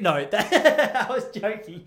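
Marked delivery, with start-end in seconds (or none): none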